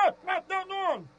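half-laughing call across the room, away from the mic; calling off-phone. Real, not acted. Caller urges Marcos from afar, half laughing.

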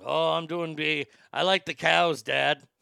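light Irish lilt for the son; sheepish Irish lad answering his dad; mild Irish son's voice; Irish accent. Retold or imitated. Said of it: "he turned Irish".

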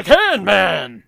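loud announcer-like name drop; mock hero proclamation. Storyteller naming the junker, loud mock hero proclamation. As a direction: blare the hero's title.

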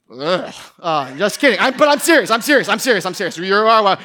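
usual speaking voice

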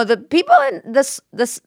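normal talking voice

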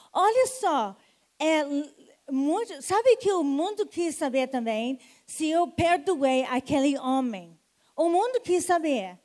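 loud, urgent altar call; rally the congregation forward; preacher calling the crowd to the altar; full-force preaching. Acted. Calls people forward to pray.

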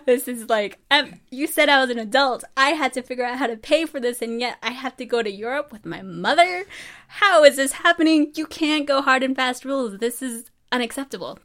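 high, loud, bratty complaining; mock a bratty teenager throwing a fit; whiny teen rant. Daughter whining to dad about mom joining the Europe trip. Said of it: "which I was just like".

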